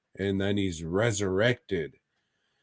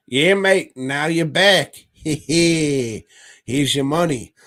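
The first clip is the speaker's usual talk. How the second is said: put-on high, loud, giggling "mate" accent; mock doctor, Cockney-style accent; 5 semitones higher, 6 dB louder, slower than usual. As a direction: cackle through a broad comic Cockney-style accent. Doctor voice answers revived man.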